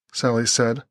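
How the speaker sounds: usual speaking voice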